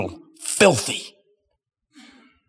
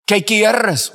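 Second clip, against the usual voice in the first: "¿Qué quieres?" said higher and louder, called out; loud question; 7 semitones higher than usual. Shown as a demonstration. Preacher calling up to the prisoner at the window, raised voice. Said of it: "Say it out loud".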